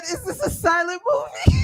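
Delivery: mock exclaim. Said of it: "Lynn said".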